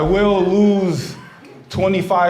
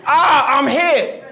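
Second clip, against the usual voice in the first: hammed-up wounded soldier cry, higher; dramatic I'm hit; 9 semitones higher, much slower than usual. Shown as a demonstration. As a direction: play the hit soldier, over the top. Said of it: "the first thing you wanna say is".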